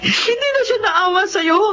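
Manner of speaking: sobbing outcry, slow and high; crying out. Acted. Mother hearing her son was wronged, crying out in grief. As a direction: cry out in grief, voice breaking.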